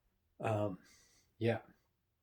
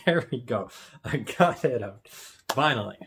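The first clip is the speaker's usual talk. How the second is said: louder, triumphant relief after a stumble; mock relief; 5 dB louder than usual. Real, not acted. Host who finally got a tricky phrase out, relieved. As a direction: celebrate finally getting the words out.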